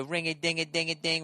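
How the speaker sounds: singsong ringing of little bells; imitated altar bells. Altar boy mimicking the ringing mass bells, singsong. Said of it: "with the fucking, the bells".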